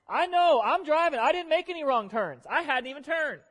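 loud, high and exasperated; loud insistence. Retold or imitated. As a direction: insist at the top of your voice. Preacher reenacts own insistence.

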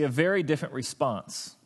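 usual speaking voice

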